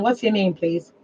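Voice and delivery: usual speaking voice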